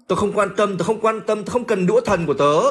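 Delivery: cao giọng, giả vờ tức tối; nhại Ron đang nổi điên, giọng cao hẳn; hammed-up furious Ron. Teacher acting out furious Ron. (giả giọng Ron giận dữ, cao vút)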